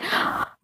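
short acted gasp of alarm; panicked gasp. Teller relives panicking over their feet, alarmed gasp. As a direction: suck in a sudden breath, imitating panic. Retelling a panic while high.

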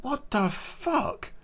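high, slow, stunned; slow incredulous what-the; stunned shock. Retold or imitated. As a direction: drag it out slowly in shock. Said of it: "And I'm like".

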